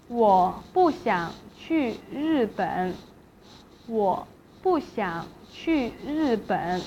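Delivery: slow, syllable by syllable, 不 falling before 想; fourth tone on 不. Shown as a demonstration. Teacher giving the third example, slow falling tone on 不. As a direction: say it slowly and drop 不. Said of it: "pronounced in fourth tone".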